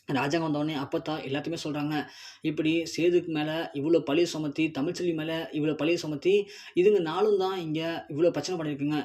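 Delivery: usual speaking voice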